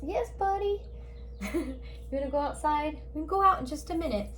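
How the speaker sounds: high, sweet voice for the pet; pet talk. Real, not acted. Breaking off to tell her cat they'll go outside soon, in a high cooing pet voice. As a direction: coo at the kitty who wants out.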